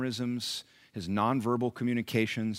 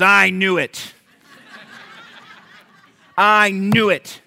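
imitated delighted outburst, higher and louder; triumphant exclamation; 10 semitones higher, 12 dB louder, slower than usual. Old gym lady learns he's a pastor, triumphant exclamation. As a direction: exclaim it like your hunch just proved true.

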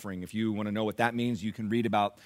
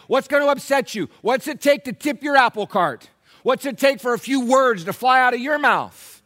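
high, loud, challenging sermon; full-force preaching; 9 semitones higher, 11 dB louder than usual. Real, not acted. Pastor challenging believers on how they react, full-force preaching. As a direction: confront the congregation with punched questions.

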